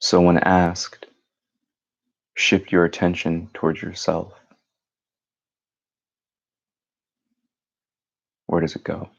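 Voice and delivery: calm meditation guide, long pauses between phrases; measured, unhurried meditation voice; guided meditation. Acted. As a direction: lead them gently inward, leaving silence after each phrase.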